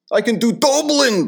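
loud, high-pitched hammed-up Irish; Dublin accent. Shown as a demonstration. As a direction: belt out a comic Dublin accent.

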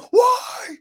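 high, loud shrieked why; mock scream. Podcaster mocks wrestler screaming, high and loud. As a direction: scream it like a tantrum. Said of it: "just screaming".

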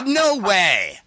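high, stretched-out incredulous denial; hammed-up shock; stunned disbelief. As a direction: refuse to believe it, dragging it out. Disbelief on hearing it's a guy.

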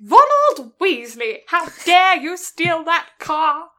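loud, slow, outraged telling-off; hammed-up indignant shout, drawn out; mock furious scolding. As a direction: scold like an enraged mother. Acts out film's angry scolding letter.

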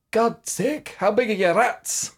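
high, slow mock Scottish outburst; hammed-up Scotsman, amazed; Scottish man. Punchline, Scotsman voiced in joke.

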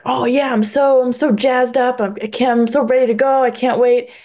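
bubbly, eager retelling of his hype; excited teammate. Retold or imitated. Teammate raves about the meeting.

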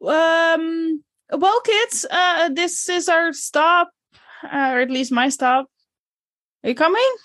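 a hint of a gentle teacher. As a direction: call the kids along like a teacher. Teacher voices Miss Tanaka.

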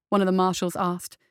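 ordinary delivery, nothing marked

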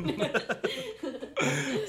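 high, slow, laughing. Pretend. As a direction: drag it out through a laugh. Echoing the name playfully, laughing.

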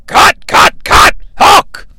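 urgent hiss. Acted. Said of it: "Vince McMahon becomes furious".

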